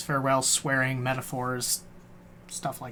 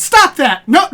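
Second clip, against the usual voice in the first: high, loud mock-angry shout; hammed-up parental yell; scolding parent; 11 semitones higher, 11 dB louder than usual. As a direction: order the kids to stop, exasperated. Acts relative scolding mixed-up names.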